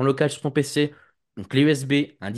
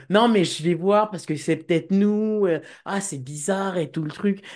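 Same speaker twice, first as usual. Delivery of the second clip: higher, louder mock technician voice; imitated puzzled technician; 7 semitones higher, 3 dB louder than usual. Technician tells client he'll look into it, mock puzzled. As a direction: mimic a baffled technician, drawn out. Technicien embarrassé imité dans le récit.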